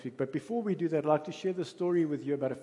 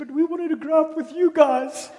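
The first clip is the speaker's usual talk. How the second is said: put-on much higher, louder little boy's plea, laughing through it; little boy punchline; 12 semitones higher, 10 dB louder than usual. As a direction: deliver the child's punchline to get a laugh. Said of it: "And the little boy said".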